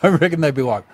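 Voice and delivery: ordinary talk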